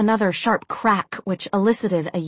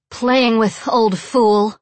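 loud, raised, furious insult; angry woman; 4 semitones higher, 6 dB louder, much slower than usual. A narrator's reading. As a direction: finish angrily despite the lashes. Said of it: "but lower and angrier".

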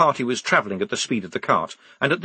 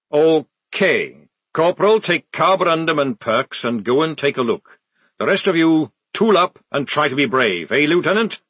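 louder, higher sergeant's orders; commanding sergeant; 5 semitones higher, 4 dB louder than usual. Acted. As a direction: order the squad about briskly.

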